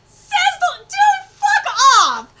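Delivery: loud, high, incredulous comeback; heckling shout; jeering retort. Real, not acted. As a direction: yell it back at them, drawn out. Yelling back at the character.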